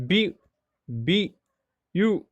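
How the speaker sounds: beautiful broken into separate syllable beats; teacher chopping the word into syllables; syllables split apart. Shown as a demonstration. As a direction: count out each syllable with a pause. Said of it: "There are three syllables".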